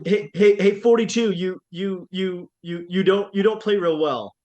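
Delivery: hammed-up nervous heckle; stammering trash talk. Imitating a kid trash-talking number forty-two, mock heckle. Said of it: "and he goes".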